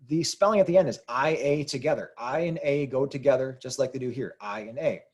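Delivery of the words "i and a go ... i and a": usual speaking voice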